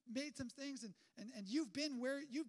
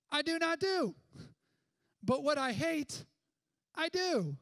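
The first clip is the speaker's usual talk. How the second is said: loud, higher preaching of Paul's words; slow, loud and higher preaching quote; emphatic proclaiming; 4 semitones higher, 11 dB louder, slower than usual. Acted. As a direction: ring it out as a confession. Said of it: "He says, he says".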